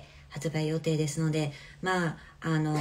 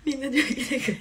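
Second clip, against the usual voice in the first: giggling through the words; amused real laughter; laughing; 6 semitones higher, 3 dB louder than usual. Real, not acted. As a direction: laugh as you say it. Laughing at fans' domain expansions.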